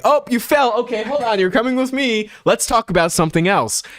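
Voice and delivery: put-on high, bright sing-song soothing a toddler; cheery distraction for a kid. Shown as a demonstration. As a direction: sweep the child along so they forget the fall. Scooping up a fallen child.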